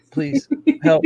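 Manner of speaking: high, pleading cry for help; mock desperate plea. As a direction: beg dramatically, voice shooting up. Mock call to Comcast.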